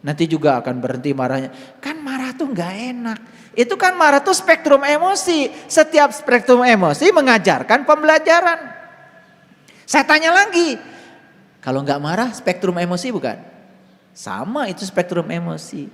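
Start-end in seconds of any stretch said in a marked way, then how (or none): 3.5-10.8 forceful preaching. Acted. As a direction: proclaim it to the whole room. Preaching about emotions.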